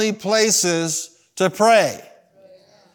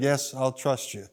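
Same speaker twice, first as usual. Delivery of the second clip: slow, soft, lowered agreement; subdued surrender; giving in; 5 semitones lower, 9 dB quieter than usual. Retold or imitated. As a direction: concede reluctantly in a small retold voice. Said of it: "He finally said and agreed".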